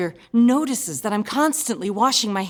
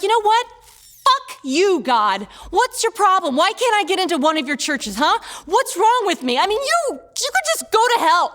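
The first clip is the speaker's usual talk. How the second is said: high, loud acted outburst of anger; swearing at God; 10 semitones higher, 4 dB louder than usual. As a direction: rail at God like an indignant nine-year-old. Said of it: "I am now swearing at God".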